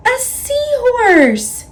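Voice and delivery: high, loud, slow delight; finally find the seahorse; a cry of pure delight; excited cry. A narrator's reading. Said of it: "cries Pete".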